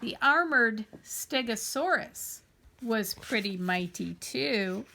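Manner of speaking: ordinary delivery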